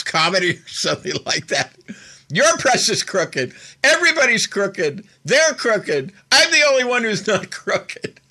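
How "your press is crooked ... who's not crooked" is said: mocking quote that rises and breaks into laughter; laughing mock rant. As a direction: imitate a ranting accuser, cracking up by the end. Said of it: "I'm laughing 'cause it's so stupid".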